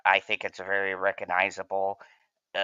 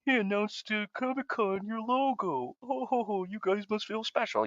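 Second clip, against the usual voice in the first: much higher, sneering put-on voice of a smug rival fan; mocking fan voice; 12 semitones higher, 5 dB quieter than usual. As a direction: squeak it out like a gloating troll. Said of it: "and they go".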